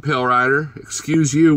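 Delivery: ordinary talk, nothing marked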